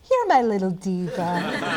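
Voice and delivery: slow, laughing pet-talk to a plant. Pretend. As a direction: coo at the orchid like a pet. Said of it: "I think that I would say".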